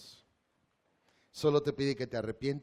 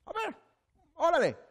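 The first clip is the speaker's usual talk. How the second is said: taunt; 3 dB louder than usual. Retold or imitated. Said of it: "esa era su postura".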